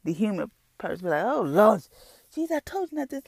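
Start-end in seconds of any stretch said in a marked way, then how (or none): 1.2-3.2 much higher, put-on whiny panic; frantic pity party. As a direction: throw a dramatic fit at God. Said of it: "go into this frantic and have a pity party".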